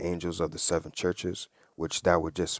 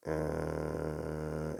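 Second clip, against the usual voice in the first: plane hum imitation; 8 dB quieter than usual. Shown as a demonstration. Preacher imitating a distant plane for his listeners, soft droning hum. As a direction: hum the far-off engine on one held note. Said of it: "I'ma try to do this the best way I can".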